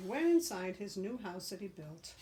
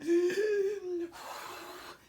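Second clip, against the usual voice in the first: loud high gasp; wolf drawing breath for the last blow; huge gasping inhale; 11 semitones higher, 6 dB louder than usual. Acted. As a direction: gulp in a giant breath. Wolf's final breath.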